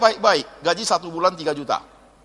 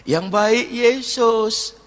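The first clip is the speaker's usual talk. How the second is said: humble reply; 3 semitones higher, much slower than usual. Retold or imitated. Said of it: "Kok baik ya?".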